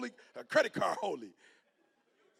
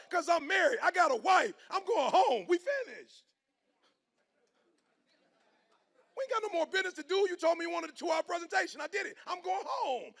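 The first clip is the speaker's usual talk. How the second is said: emphatic refusal; 11 semitones higher than usual. Retold or imitated. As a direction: shut the host down firmly. Retold refusal to the host.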